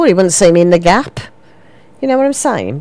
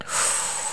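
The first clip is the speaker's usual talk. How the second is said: soft, breath-only sigh; weary sigh; 14 dB quieter than usual. Real, not acted. Umming and ahhing over a charm, sighing. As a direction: let out a quiet sigh of indecision. Weighing up which charm to buy.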